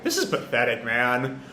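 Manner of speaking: low, quiet disdain; dismissive, dropped-voice put-down; almost insulting. Retold or imitated. Zoro to the weeping Momo, almost insulting. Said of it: "Zoro almost insulting".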